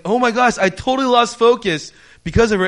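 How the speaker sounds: loud, high-pitched exasperated outburst. Retold or imitated. Player whose game was interrupted by staff, loudly exasperated. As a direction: let the frustration burst out.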